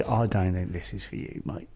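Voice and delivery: low, quiet imitation of the promoter; a lightly voiced promoter letting him down; retold promoter. Promoter telling the young comic it's not for him, low and quiet. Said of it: "he said".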